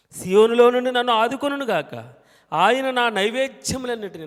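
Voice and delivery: full-voiced scripture proclamation; preaching at full force. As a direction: proclaim each line so the church follows. Recites the psalm again.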